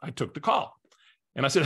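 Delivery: normal talking voice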